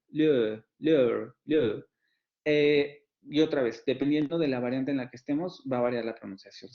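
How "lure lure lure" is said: "lure" with a rounded vowel gliding into schwa; English "lure". Shown as a demonstration. Teacher modelling a diphthong, careful pronunciation. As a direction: drill the word "lure" for the class. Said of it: "como en el caso de lure".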